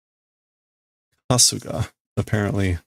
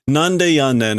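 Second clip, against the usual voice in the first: a light Osaka-ben quip at normal speed; Osaka-ben retort; 4 semitones higher, 4 dB louder than usual. Retold or imitated. The Osaka comedian fires back with a light Osaka-ben retort. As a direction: toss off the Osaka-ben nande yannen as a retort. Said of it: "this is Osaka Ben".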